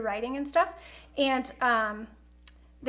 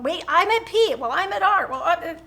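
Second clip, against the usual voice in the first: mimicking her kids; 4 semitones higher, 5 dB louder than usual. Kids calling out letters.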